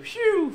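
high whooshing "phew"; quick high-pitched swoosh; flashback whoosh. Acted. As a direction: mouth a flashback sound effect. Flashback transition sound effect.

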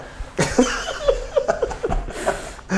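long, high, airy laughter; helpless soft giggling while tearing up; real breathless tearful laugh; laughing through tears. Real, not acted. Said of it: "You got me crying".

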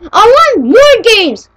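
high, loud crying whine; whiny cry. Pretend. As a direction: wail it like a sulking child.

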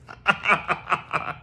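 bright burst of laughter; an amused high laugh; real laugh. Real, not acted. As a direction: laugh at your own punchline. Laughs at punchline.

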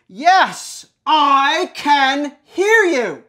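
each word dragged out in irritation; slow, exasperated. Acted. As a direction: spell it out slowly, fed up. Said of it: "no reason to get mad at me".

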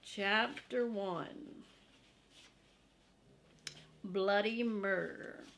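usual speaking voice

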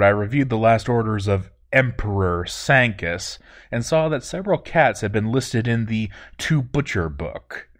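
usual speaking voice

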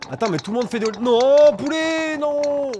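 high, loud protest; excited protest. Real, not acted. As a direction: yelp it out in disbelief.

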